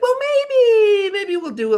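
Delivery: very high, loud, drawn-out falsetto; hammed-up squeal sliding down from the top; mock squealed backtrack. Imitating Trump backing off the tariffs, mock high squeal.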